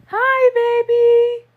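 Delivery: very high, slow pet-talk greeting. Real, not acted. Baby talk to cat.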